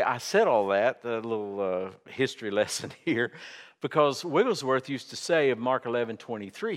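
ordinary delivery

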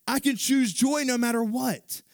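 high, loud preaching voice; full-force preaching. Acted. Preacher driving the point home, full force. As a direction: preach it hard to the congregation.

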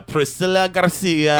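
hammed-up Italian accent, drawn out; slow, put-on Italian lilt. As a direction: roll out the name slowly in mock Italian. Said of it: "I did fucking an Italian thing".

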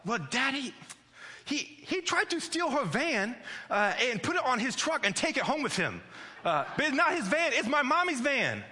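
high-pitched indignant toddler imitation; worked-up little girl. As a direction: imitate a three-year-old protesting, very high. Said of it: "she's worked up".

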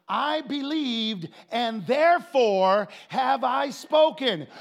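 slow, building to a very loud declaration; drawn-out preaching force on the verse; emphatic scripture reading. Real, not acted. Scripture reading built to climax.

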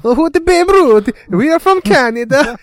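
very high, loud cartoon Canadian; hammed-up Canadian accent, shouted high; mock Canadian accent. Co-host joining in as proud Canadians, mock Canadian accent. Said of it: "the Canadians were like".

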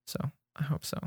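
ordinary talk

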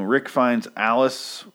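ordinary talk, nothing marked

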